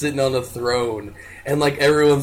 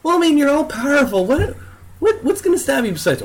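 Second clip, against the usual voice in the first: high, exasperated ranting; 12 semitones higher than usual. Real, not acted. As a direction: push it up in disbelief. Exasperated argument about Superman.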